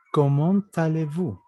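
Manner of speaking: slow "comment allez-vous", the T of "comment" carried into "allez"; liaison T pronounced. Shown as a demonstration. Teacher introduces another greeting, "comment allez-vous" with sounded T. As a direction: say "comment allez-vous" slowly as "comont-alé-vu". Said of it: "la T es muda".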